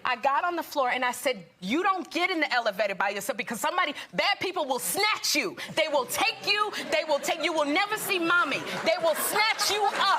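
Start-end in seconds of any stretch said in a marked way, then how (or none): 1.6-10.2 put-on frighten the child on purpose; scary warning. Mother warning her son about strangers, mock dramatic. Mom scolding son after scare.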